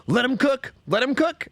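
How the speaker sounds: teasing imitation of young slang; mocking young people. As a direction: parrot the kids mockingly. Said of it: "Everyone's like".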